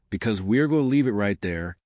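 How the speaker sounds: ordinary delivery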